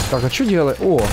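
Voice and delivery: ordinary talk, nothing marked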